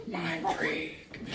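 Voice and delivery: slow, hushed stage-magician catchphrase; Chris Angel. Retold or imitated. Joking to audience about street magicians, slow mysterious whisper.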